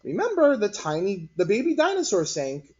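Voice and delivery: put-on a little girl reminding the others; reminding child. A narrator's reading. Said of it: "Lupita reminded them".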